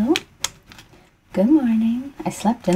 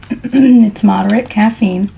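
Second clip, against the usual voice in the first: hoarse morning voice; froggy, throaty early morning; 3 semitones higher, 6 dB louder, slower than usual. Real, not acted. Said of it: "I'm kinda froggy".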